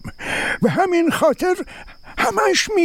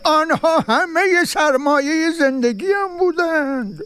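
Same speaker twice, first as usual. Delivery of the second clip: dismayed cry; 6 semitones higher than usual. Acted. Coins thrown into the well.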